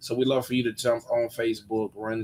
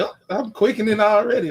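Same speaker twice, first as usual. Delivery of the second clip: preacher voice; 8 semitones higher, 7 dB louder than usual. Retold or imitated. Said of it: "a good preacher voice".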